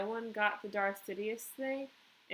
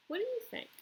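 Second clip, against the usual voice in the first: much higher question to listeners; asking the class; 13 semitones higher, faster than usual. Real, not acted. Teacher asks her listeners for their view, much higher. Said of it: "asks the class".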